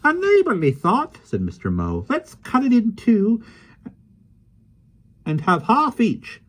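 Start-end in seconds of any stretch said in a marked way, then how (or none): 0.0-1.2 put-on high, lively voice of a small old man; gentle, chatty little fellow; kindly little man. Mister Mo speaks. 2.1-6.4 put-on high, lively voice of a small old man; gentle, chatty little fellow; kindly little man. Mister Mo speaks.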